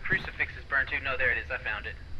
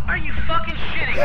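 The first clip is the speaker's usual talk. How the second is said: shouted, high incredulous outburst; loud disbelief; 13 semitones higher, 12 dB louder than usual. Real, not acted. Friend sees the player killed, shouted disbelief. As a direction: yell it in disbelief.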